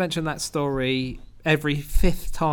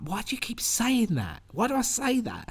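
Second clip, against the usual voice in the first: exasperated self-questioning; 8 semitones higher, 4 dB quieter than usual. Retold or imitated. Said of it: "and I'm like".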